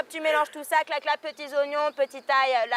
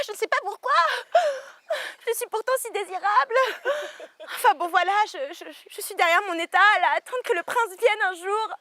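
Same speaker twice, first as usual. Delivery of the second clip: higher, tearful, hammed-up tragic wail; overplayed melodrama; 6 semitones higher than usual. Asked about marriage, she wails in hammed-up melodrama. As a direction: overact the despair like Phèdre. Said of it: "En surjouant le mélodrame".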